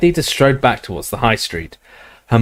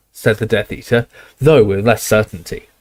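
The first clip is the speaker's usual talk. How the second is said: ordinary delivery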